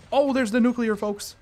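loud, high hype call; excited announcement. Real, not acted. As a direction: announce a big win to your viewers. Gamer announces earning a nuke.

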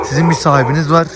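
usual speaking voice

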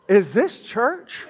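slow, puzzled question; imitated bewilderment. First-time visitor questioning the service, imitated bewilderment. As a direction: ask it slowly, as a baffled newcomer. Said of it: "here for the first time today going".

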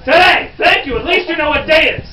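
loud, high burst of mock relief; exasperated thanks. Guide thanking the crowd for knowing the day, loudly exasperated. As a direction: exclaim with theatrical exasperation.

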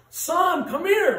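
put-on loud, high, urgent shout to a child in danger; urgent yell. Father yelling to his son about to be hit by a car, loud urgent shout. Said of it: "urgently, loud".

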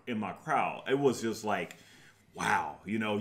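ordinary talk

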